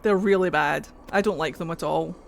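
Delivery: usual speaking voice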